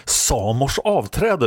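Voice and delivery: high, drawn-out oath; exclaimed curse. A narrator's reading. Wounded fighter grabs her lover's shoulder, high exclaimed curse. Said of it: "utbrast hon".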